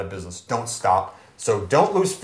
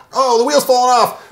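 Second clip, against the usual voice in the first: mock alarm; 11 semitones higher, 7 dB louder than usual. Car analogy, business breaking down.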